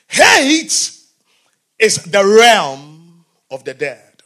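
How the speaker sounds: preacher stresses Hate to the congregation. Shown as a demonstration. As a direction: punch Hate, pause, then slow down. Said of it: "emphasize on that word hate".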